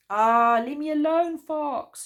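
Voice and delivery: high, very loud furious yell; angry shout. A narrator's reading. Bear, confused by Fox, yells high and loud in anger. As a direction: explode at Fox. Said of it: "shouted Bear angrily".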